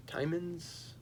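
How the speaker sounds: Timons with long i, softly, as TIE-munz; tentative pronunciation. Shown as a demonstration. Reviewer guessing at an Italian name, hushed TIE-munz for Timons. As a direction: try a quiet, questioning TIE-munz for Timons. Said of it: "trying to think how I pronounce".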